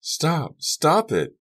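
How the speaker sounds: high-pitched urgent shout; female voice calling. A narrator's reading. Girl calling across the tennis courts, urgent high cry. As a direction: call out high to halt a fight. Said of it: "Another voice, a female voice, called".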